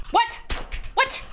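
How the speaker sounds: squeaky, incredulous questions; short, stunned high-pitched outbursts; high-pitched disbelief. Real, not acted. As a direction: pop each one out high, with pauses. Disbelief at game result.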